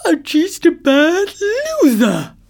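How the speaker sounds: high, loud words through a yawn. A narrator's reading. Sleepy tortoise dismisses the beaten elephant, yawning. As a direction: stretch the taunt into a yawn. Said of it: "said the tortoise with a yawn".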